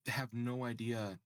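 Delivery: ordinary talk, nothing marked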